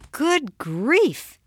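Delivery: high-pitched exasperated outburst; acted mom at her limit; exasperated mother. A narrator's reading. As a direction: exclaim it, fed up with the kids. Overwhelmed mother answers her children.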